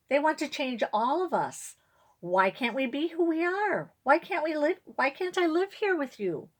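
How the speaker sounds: high upset pleading voice; plead, voice climbing; pleading girl. Acted.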